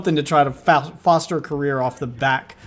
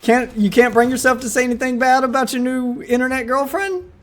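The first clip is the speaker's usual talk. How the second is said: higher, jeering push; high goading taunt; 7 semitones higher than usual. Real, not acted. Commentator goads the streamer into answering, higher and jeering. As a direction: goad him to speak up.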